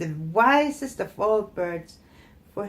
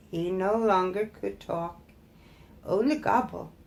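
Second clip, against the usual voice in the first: ordinary delivery, nothing marked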